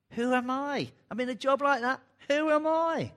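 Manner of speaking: high, loud, pleading cry of self-doubt; anguished protest. Acted. Preacher voicing Moses' panicked doubt, high and loud. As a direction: throw it up high in rising despair.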